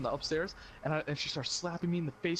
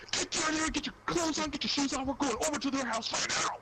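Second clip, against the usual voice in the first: much higher urgent commands; high mock mom order; 12 semitones higher than usual. Mom ordering son to dress, high urgent mock. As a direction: bark orders like a mad mother.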